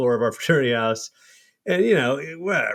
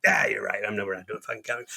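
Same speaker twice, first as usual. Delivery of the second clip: softer, fast, mumbled agreement; meek caving in; 4 semitones lower, 6 dB quieter, much faster than usual. Retold or imitated. [name] gives in to Kevin, soft and mumbled. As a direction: fold quietly and hurry through it. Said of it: "I'm like".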